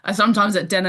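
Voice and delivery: ordinary talk, nothing marked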